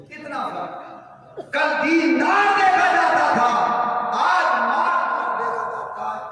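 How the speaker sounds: impassioned sermon. Acted. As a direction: chant the contrast to the crowd. Sermon cadence, raised pitch. (तरन्नुम में was)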